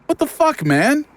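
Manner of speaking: high disgusted cry of shock; shocked outburst. Acted. Rufus shown a severed hand, shocked high cry. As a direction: recoil in horror.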